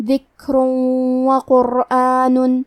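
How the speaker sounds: ذکر وقرآن joined, the tanween merged into the و with a nasal hum, very slow; idgham with ghunna. Shown as a demonstration. Teacher demonstrating idgham to students, slow nasal joining. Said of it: "غنہ اور تشدید کے ساتھ ادا کریں گے".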